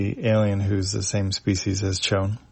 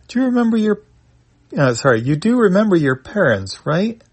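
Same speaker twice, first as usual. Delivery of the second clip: much higher, hesitant alien voice; 10 semitones higher, 6 dB louder than usual. Acted. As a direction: ask it high and unsure. Alien asks Chon.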